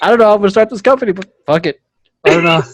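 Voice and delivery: fast, loud, high and laughing; carefree creator voice. Retold or imitated. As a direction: blurt it out with a laugh. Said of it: "the first thing people say".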